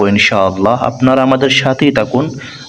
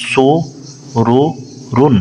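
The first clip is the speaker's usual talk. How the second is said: drawn-out model reading; much slower than usual. Shown as a demonstration. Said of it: "পেশ উচ্চারণ করার সময় ঠোঁট গোল হবে".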